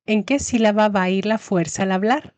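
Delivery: ordinary talk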